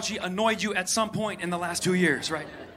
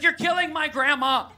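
higher, laughing accusation; hammed-up horrified lady; pitched-up mock outrage with a laugh; mock accusing lady; 6 semitones higher than usual. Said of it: "She's like".

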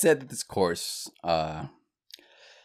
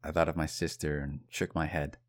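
normal talking voice

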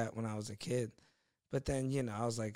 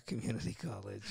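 lower, soft dismissive mumble; mock shrugging excuse; 3 semitones lower, 5 dB quieter than usual. To an angry driver, a lower shrugging excuse. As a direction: brush it off like it explains everything. Joke excuse after car crash.

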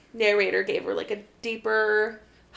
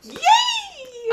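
squealed high yay with a laugh; excited cheer; 4 dB louder than usual. Real, not acted. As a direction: cheer her on, delighted. Friend cheering about finished book.

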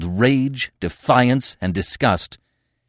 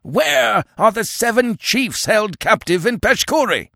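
very high, strained crowd shout; roared demand; 13 semitones higher, 3 dB louder than usual. Acted. Tribesmen demand their captive chiefs from Conan, roaring. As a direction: demand it as an accusing mob. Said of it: "they roared back wholeheartedly".

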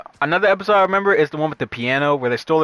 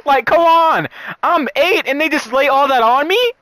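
high, loud incredulous come on; can't believe they did that; outraged disbelief; 10 semitones higher, 4 dB louder than usual. Real, not acted.

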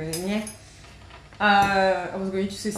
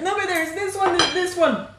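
insistent arguing; 9 semitones higher than usual. Retold or imitated. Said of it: "we were struggling so much to be like".

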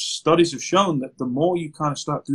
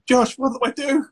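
high mock panic, much higher pitch; panicking client; 10 semitones higher than usual. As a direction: play the frantic client pleading for help. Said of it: "they're panicking".

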